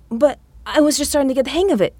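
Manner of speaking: high, fast protesting whine; whiny young man. A narrator's reading. Victor protests being sent off.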